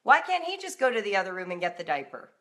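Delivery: ordinary delivery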